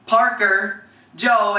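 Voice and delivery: usual speaking voice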